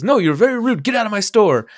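high, mocking scold of the manager; angry manager. Manager ordering customer out, high mocking scold. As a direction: throw the customer out in a squeaky huff. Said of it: "He goes".

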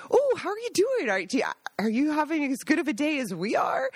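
high, bubbly small talk; chipper greeting. Retold or imitated. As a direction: overeager friendly chatter. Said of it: "and he'd say".

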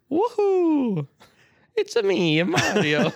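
high, bouncy Italian plumber voice; cheerful cartoon Mario cry. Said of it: "I was thinking in my head".

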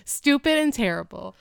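loud, high verdict after a laugh; amused scorn. Real, not acted. Wife panning her husband's joke, loudly amused. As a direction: pronounce it awful, loudly, still amused.

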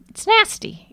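high squealed disgust; a sharp yuck of disgust. Real, not acted. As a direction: spit out the word in disgust. Disgust at chemical smells.

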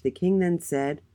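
ordinary delivery

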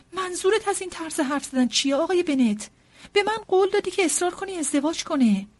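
high, loud, astonished protest; affronted wife taking husband to task; indignant wife. Acted.

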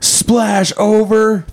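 high, very slow radio call. Acted. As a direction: draw it out like a radio report. Radio call exchange acted.

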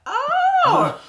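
very high, loud drawn-out mock revelation; sudden realisation. Student shown her due dates by tutor, sudden realisation. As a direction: overplay the moment it all clicks. Learning when assignments are due.

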